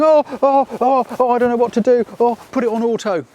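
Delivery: high, loud, hammed-up fluster; mock panic. Unprepared tourist in the safari lander, mock panic.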